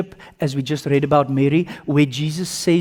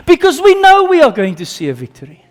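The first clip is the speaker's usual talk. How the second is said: excited declaration; 10 semitones higher, 7 dB louder than usual. Acted. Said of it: "spoken answer to the chorus".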